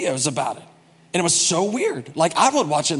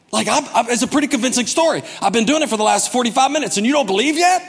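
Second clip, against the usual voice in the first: higher-pitched, incredulous pestering; mock impatience; 6 semitones higher than usual. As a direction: push the listener with exasperated disbelief. Acting out an impatient evangelist.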